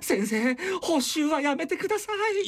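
high, whiny schoolboy plea; pleading student. Acted. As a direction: beg the teacher like a kid. Plays pleading student to teacher. (先生に泣きつく生徒の声)

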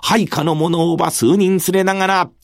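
usual speaking voice

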